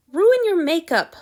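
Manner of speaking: a fussy painter complaining about his model; grousing. A narrator's reading. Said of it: "Lord Antony groused".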